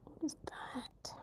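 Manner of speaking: slow, hushed whisper to self; whispered puzzling. Real, not acted. Puzzling to self over an unknown word, slow whisper. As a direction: whisper it while working it out.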